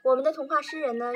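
ordinary delivery

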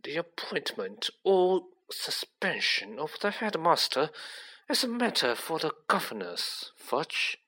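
silky official condescension; smooth. A narrator's reading. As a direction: put him down smoothly and quietly. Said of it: "said Mister Malfoy smoothly".

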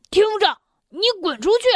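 high, very fast angry yell; grumpy shout. Acted. Mr. Grumpy yelling at Mr. Happy to get out. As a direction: bark him out of the garden, fast. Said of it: "暴躁先生大嚷着".

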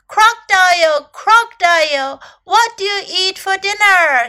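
high, loud call across the river. A narrator's reading. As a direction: call the name, then ask in a high voice. Elephant calls out to crocodile.

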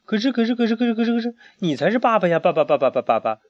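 high, tumbling stammer that ends in a laugh; puzzled stammer. A narrator's reading. Lawrence baffled asks his father, high stammering then laughing. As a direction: stumble over the words in confusion. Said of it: "劳伦斯奇怪地问狐狸老爸".

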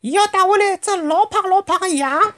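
put-on very high, childlike chirping; little cub voice. A narrator's reading. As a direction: speak like a tiny eager animal child. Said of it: "另外一只小物事讲".

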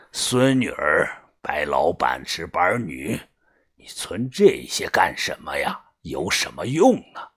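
low, slow, quiet scolding; disapproving gang boss. A narrator's reading. Boss scolding Yunfeng about his useless contacts, low and slow. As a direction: list them with weary, low disapproval. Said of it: "把头皱着眉说".

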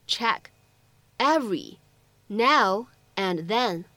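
each word separate, with a pause after it; slow dictation, one word at a time. Shown as a demonstration. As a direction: read it out in isolated words for learners. Teacher models sentence word by word.

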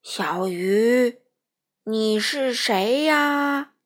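loud, slow booming whale voice. Acted. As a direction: boom out like a huge whale. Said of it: "那声音说".

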